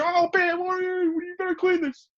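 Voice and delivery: very high whiny scolding; imitating Don Vito. Don Vito scolding Bam in his house, high whine. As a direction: scold Bam in a high cracked old-man voice. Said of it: "He's like".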